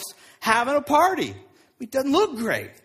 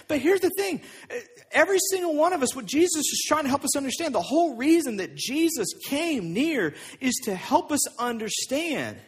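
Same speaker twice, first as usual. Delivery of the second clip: ordinary delivery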